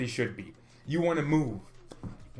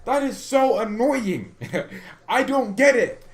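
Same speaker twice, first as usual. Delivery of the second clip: higher and louder exasperation; annoyed; 8 semitones higher, 5 dB louder, slower than usual. Real, not acted. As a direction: vent real frustration at the club. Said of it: "this is annoying".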